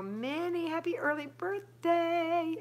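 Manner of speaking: high, slow, sing-song greeting; bright birthday cheer; sing-song birthday wish. Retold or imitated. Birthday wishes read out.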